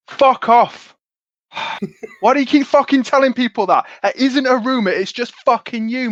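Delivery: high, loud outraged protest; mock-furious, voice pitched right up; indignant outburst. [name] protesting at the rumor about him, indignant yell.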